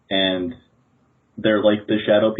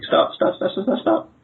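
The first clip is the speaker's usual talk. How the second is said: high-pitched, rapid-fire plea; acted panicky protest; urgent pleading; 12 semitones higher than usual. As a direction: beg them to stop, voice jumping up high. Character pleads to stop bashing.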